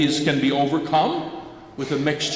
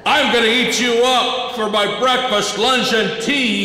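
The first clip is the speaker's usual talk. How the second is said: higher and louder hammed-up troll bellow; troll threat; 8 semitones higher, 4 dB louder than usual. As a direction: roar it out like a greedy troll. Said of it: "he said".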